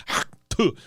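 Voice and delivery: throaty mock spit; imitated spit. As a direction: hawk and spit noise. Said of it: "spits on the boot".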